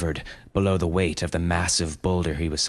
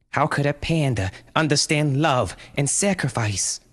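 mother crying out; 8 semitones higher than usual. A narrator's reading. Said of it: "cried Brenda".

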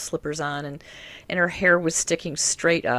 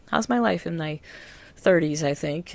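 usual speaking voice

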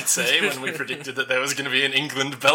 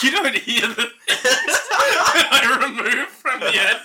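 laughing; 7 semitones higher than usual. Retold or imitated. Retorting while laughing.

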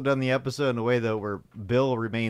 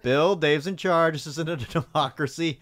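lightly voiced firm boss, raised pitch; a bit louder and higher, mock-authoritative; Mister James; 4 semitones higher, 5 dB louder than usual. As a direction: imitate a boss laying down the law. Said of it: "Mister James right here".

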